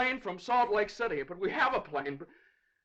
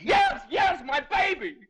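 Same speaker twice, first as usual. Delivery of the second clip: high, loud, desperate cry; anguished shout; 8 semitones higher, 6 dB louder, slower than usual. Acted. Father confirming his baby is dying, shouting in anguish.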